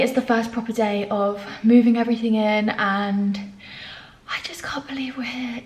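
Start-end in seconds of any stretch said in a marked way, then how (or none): 4.3-5.6 hushed, softer, in disbelief; breathless whispered wonder; awed whisper. Real, not acted. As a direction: whisper it like a secret joy. Emotional first day at flat.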